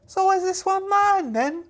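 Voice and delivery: very high, eager question; eager young lad. Acted. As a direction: ask it high like a keen boy. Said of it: "as Bates goes".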